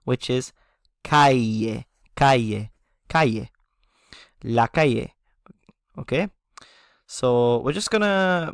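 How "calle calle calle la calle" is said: "calle" repeated as KA-ye; repeated teacher model of "calle"; Spanish calle. Shown as a demonstration. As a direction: drill "calle" for learners. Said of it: "the word for street".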